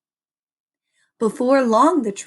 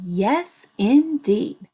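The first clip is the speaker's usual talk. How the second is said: usual speaking voice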